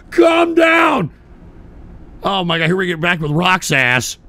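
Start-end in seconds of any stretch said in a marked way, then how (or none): 0.1-1.1 high, loud plea for calm; urgent high-pitched yell; alarmed shout. Real, not acted. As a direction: shout over someone to stop them. Tries to halt the quarrel.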